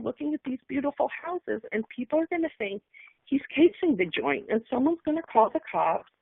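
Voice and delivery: frightened rush of worry for him; terrified. Retold or imitated. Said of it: "I suddenly felt absolutely terrified".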